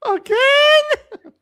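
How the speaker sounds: very high loud cartoon squeak. Cartoon voice bit.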